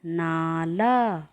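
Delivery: "नाला" drawn out slowly, long a held; slow clear word. Shown as a demonstration. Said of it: "शब्द बनेगा". ("नाला" को बहुत धीरे, आ खींचकर बोलना)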